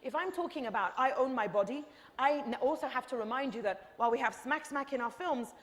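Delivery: ordinary talk, nothing marked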